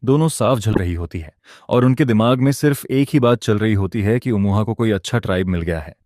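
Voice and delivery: ordinary delivery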